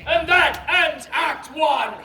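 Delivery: slow, loud showman announcement; big announcement. Real, not acted. Showman closes Act One for the audience, loud announcement. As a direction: proclaim it slowly to draw applause.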